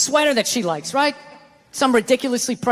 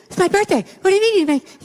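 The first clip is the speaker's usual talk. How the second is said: high, loud mock panic; freaking out; 7 semitones higher, 3 dB louder than usual. Girlfriend freaking out over birthday reservations, high and loud. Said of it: "She starts freaking out".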